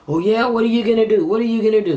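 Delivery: high, loud jeering caricature; mocking taunters. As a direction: mimic bullies daring someone to act. Mimics opponents' taunting reply.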